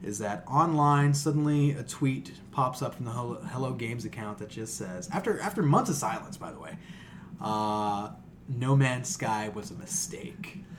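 normal talking voice

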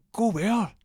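high, loud baffled driver voice; confused driver. Retold or imitated. Cab driver asks where to, high and baffled. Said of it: "The driver goes".

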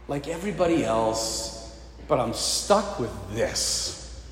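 frustrated, resigned. Retold or imitated. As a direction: sink into a glum, mock complaint. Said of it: "he's super frustrated".

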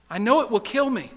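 high-pitched, anxious wail; frightened ghost protesting; fearful protest. Acted. Ghost fears being killed.

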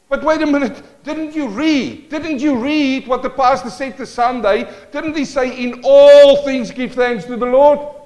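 high, loud, slowed reminder; acted rebuke. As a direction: lean in and press the point.

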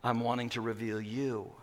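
low, soft tender voice; hushed and loving. Acted. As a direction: speak it like a gentle revelation. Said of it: "he's saying".

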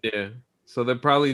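normal talking voice

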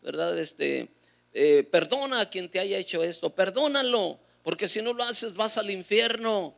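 high, urgent retold cry; retold shout. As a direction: plead urgently into a dying man's ear. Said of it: "le grité al oído".

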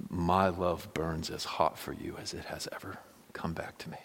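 soft, earnest whispered vow; tender whisper. Pretend. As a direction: hold her gaze and whisper it.

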